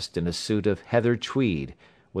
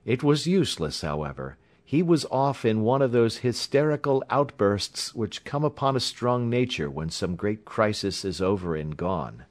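ordinary delivery

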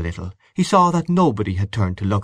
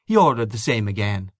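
plain reading voice

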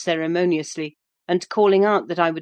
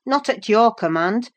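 higher, louder comeback; defiant retort; 6 semitones higher, 6 dB louder than usual. A narrator's reading. Young man refusing the woman's orders, retorting. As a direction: throw the order straight back. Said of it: "retorted Hareton".

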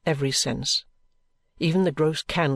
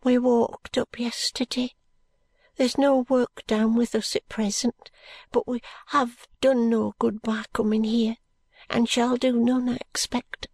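high, slow, weary voice of a poor working woman; a tired country wife answering the detective; 9 semitones higher, slower than usual. Acted. Poor brickmaker's wife answers Bucket.

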